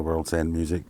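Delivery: normal talking voice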